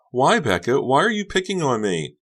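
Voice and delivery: high, loud tearful plea; Honey sobbing. Acted. Honey sobs at Becca, high and loud. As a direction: plead through a sob.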